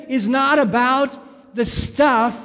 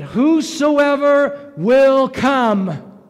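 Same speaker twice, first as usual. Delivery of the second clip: repeated "whosoever" rising higher and louder, the last one slow; building emphasis; 4 semitones higher, 3 dB louder, slower than usual. Real, not acted. Preacher calling out to the church, building to a ringing shout. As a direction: build each "whosoever" up to a stretched, ringing peak.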